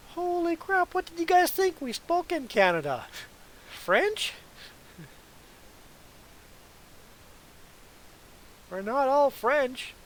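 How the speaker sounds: high-pitched, exaggerated disbelief; squeaky-high mock outrage; mock incredulity. Acting out own astonished reaction.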